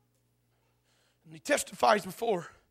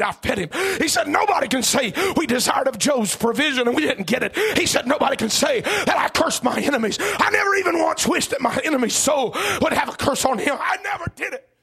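loud, impassioned sermon shout; full-force preaching; 6 semitones higher, 11 dB louder than usual. Acted. Preacher retelling Job's defence to the congregation, full-force preaching. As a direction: preach it at full cry, driving each phrase.

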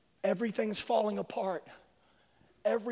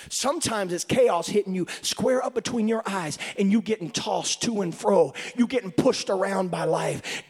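louder, driving preached delivery; full-force preaching; 6 dB louder than usual. Real, not acted. Preacher pressing the congregation about life's chaos. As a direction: press it home like a revival sermon.

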